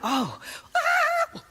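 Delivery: high falsetto "oh, ah"; high mock holy ghost cry. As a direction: cry out as if caught by the spirit. Playing at catching the Holy Ghost.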